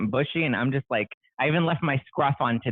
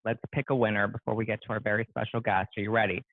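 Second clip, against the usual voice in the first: usual speaking voice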